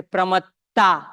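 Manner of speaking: "pramatta" with a long, stressed AA ending; mispronounced final vowel. Shown as a demonstration. Teacher showing what learners might say, "pramatta" ending in long AA. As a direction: draw out the last a of "pramatta" as "pramattaa". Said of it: "Otherwise, you might say".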